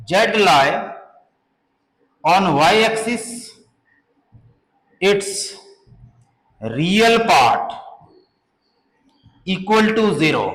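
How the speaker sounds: slow, spaced-out dictation pace. Shown as a demonstration. Teacher dictating a definition to the class, slowly. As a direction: read it out word by word so students can write.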